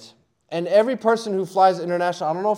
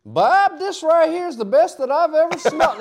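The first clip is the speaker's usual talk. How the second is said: country guard voice; 11 semitones higher, 5 dB louder than usual. Retold or imitated. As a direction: gush over the weed like a country cop. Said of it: "he's like".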